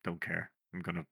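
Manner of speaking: quiet, fast, offhand threat. Retold or imitated. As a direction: mutter it as if bored. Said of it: "[name] was just his normal".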